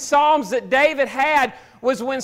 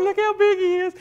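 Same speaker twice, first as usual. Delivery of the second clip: lightly mocking, higher awed voice; scared soldiers; 6 semitones higher than usual. Preacher mimics Israel's army gawking at Goliath, mild mock awe. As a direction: ham up the fearful gawking, pitched higher. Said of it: "sitting there going".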